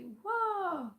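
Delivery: loud, high whoa falling away; cry out. A narrator's reading. Jack crying to the sawhorse before the wall, loud shout. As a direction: yell it so the horse stops. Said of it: "Jack ventured to cry".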